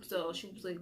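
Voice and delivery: ordinary talk, nothing marked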